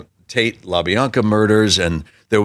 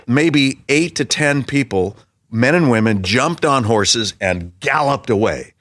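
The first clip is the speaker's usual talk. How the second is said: ordinary delivery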